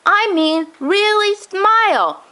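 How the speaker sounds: put-on high old man's voice, slow and insistent on really smile; insistent grandpa. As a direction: press the officer like a stubborn grandpa.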